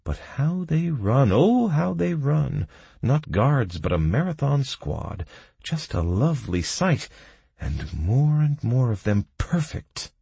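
high-pitched gloating admiration; consultant, delighted. Acted.

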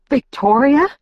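high, rising alarmed question; choked fear. A narrator's reading. Bella guesses who the intruder was, choked. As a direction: gasp the name out as a question. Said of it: "I choked".

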